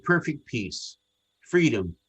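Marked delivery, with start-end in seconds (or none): none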